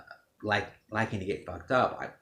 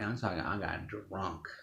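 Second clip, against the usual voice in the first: ordinary talk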